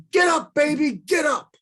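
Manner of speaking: high, loud announcer's shout; Mike Shannon home run call. Retold or imitated. The speaker imitating Mike Shannon's home run call, loud shout. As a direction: belt it out like a radio play-by-play man. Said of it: "Mike Shannon is famous for saying".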